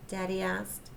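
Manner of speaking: ordinary delivery, nothing marked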